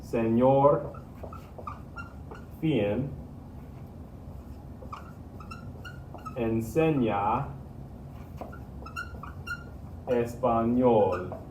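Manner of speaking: word by word, long pauses between; slow model sentence. Shown as a demonstration. Teacher building a sentence for students, slow and deliberate. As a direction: stretch each Spanish word out slowly for learners. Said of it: "make this all into a sentence".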